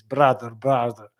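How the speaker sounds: slow, lightly Russian-accented demo; a wee bit of Russian accent, drawn out; rough mock Russian accent. Shown as a demonstration. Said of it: "just a wee bit of Russian like this".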